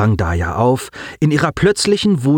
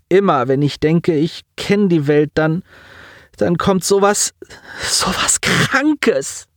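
put-on high, strained voice of a grieving boy; a young man fighting back tears; lightly voiced teen on the edge of crying; grieving boy Simon; 8 semitones higher than usual. A narrator's reading.

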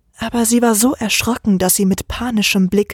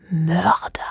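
whispered, low and cold; cold hissed whisper; 4 semitones lower, 4 dB quieter than usual. Acted. Oleg calls Michael a murderer, cold whisper. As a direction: hiss the word like a shadow. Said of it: "fauchte er kalt".

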